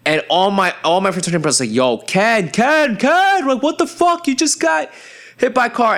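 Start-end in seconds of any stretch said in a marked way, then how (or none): 1.7-6.0 high-pitched alarmed shout to a friend; frantic calling out. Retold or imitated. Fraternity brothers to friend just hit by car, frantic yelling. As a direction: yell it like a panicked frat brother.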